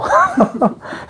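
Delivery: a high burst of laughter; sudden high giggle; real laugh. Real, not acted. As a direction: laugh out at the joke. Laughs at his own example.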